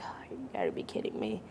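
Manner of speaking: breathy whisper of despair; whispered dismay. Retold or imitated. Teen hearing the mentor will come tomorrow, whispered dismay. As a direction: whisper it like your plans just died. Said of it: "And I'm like".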